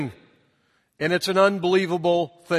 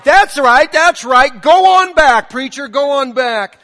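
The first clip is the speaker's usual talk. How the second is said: mock shouted amen, higher and louder; old lady cheering; 6 semitones higher, 9 dB louder than usual. Old lady urges the preacher on, mock shout. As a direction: cheer him on from the back. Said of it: "and she's like".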